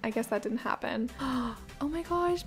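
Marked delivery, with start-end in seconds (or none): 1.1-1.7 soft, sudden gasp; quick delighted intake of breath; surprised gasp. Real, not acted. Hoped-for find suddenly turns up. 1.8-2.5 delighted surprise. Real, not acted. Hoped-for find suddenly turns up.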